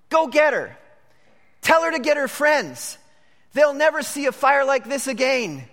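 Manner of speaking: rally them to come see the blaze; excited shout. Retold or imitated. Edison's father sending his son for his mother, excited shout. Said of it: "he shouted".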